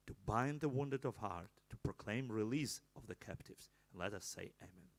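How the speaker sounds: quiet, measured prayer read aloud. Acted. Leader reading the soldiers' prayer to the gathering, hushed and solemn.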